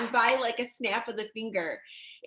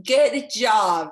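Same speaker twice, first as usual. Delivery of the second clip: louder, punchy good job; proud cheer; 7 dB louder than usual. Real, not acted. Mentor cheering the Mexico trip winners, louder. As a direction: cheer it out to the team. Echoing Cindy's famous catchphrase.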